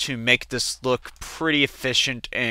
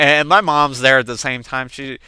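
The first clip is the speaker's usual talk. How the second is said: ordinary delivery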